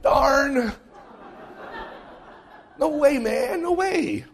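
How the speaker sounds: high, laughing mock kid protest; a few kids groaning at the bishop, high and laughing. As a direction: whine it like a disappointed kid. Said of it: "you saw a few kids go".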